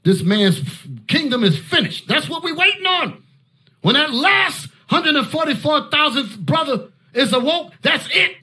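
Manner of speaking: rising, stretched preacher's cry; preaching at full force. Acted. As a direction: lift it up like a street preacher.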